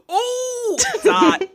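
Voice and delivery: high gasp, then a delighted cry of the name; thrilled that Dot shows up; excited gasp. Real, not acted. Gasps as a character enters.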